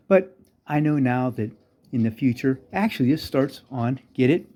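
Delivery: ordinary talk, nothing marked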